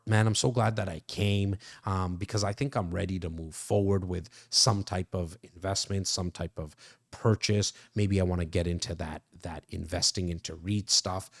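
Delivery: low, subdued imitation of a prospect; lightly voiced client. Acting out Bo's alternative reply.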